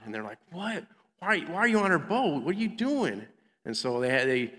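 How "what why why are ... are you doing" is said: high-pitched, disbelieving questioning; incredulous crew. Acted. Preacher voices sailors questioning Jonah on their boat, incredulous. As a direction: act out baffled sailors, pitch jumping up.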